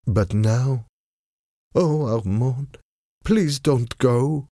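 rising, slow, a hint of despair; pleading. A narrator's reading. Marguerite begging Armand to stay, slow and pleading. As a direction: reach out to him one last time.

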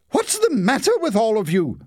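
exasperated high-pitched question; alarmed demand. A narrator's reading. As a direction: call out sharply to the whole family. Mother alarmed amid family uproar.

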